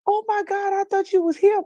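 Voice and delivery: gasp, then high-pitched mock horror; hammed-up, high "oh my God" panic; imitated shocked realization. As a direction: act out the niece realizing her blunder. Retelling niece realizing her mistake.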